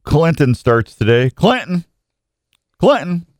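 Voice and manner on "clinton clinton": high sing-song call of the town name; playful call-out. Acted. Radio host hyping the town name to his listeners, playful high call-out. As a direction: call it out up high like a hype man.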